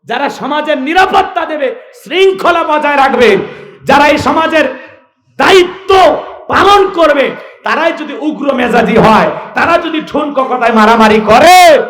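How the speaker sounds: high, loud rhetorical oratory; full-force preaching. Acted. Preacher questions the police's conduct, loud and impassioned. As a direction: ring out each clause to the crowd.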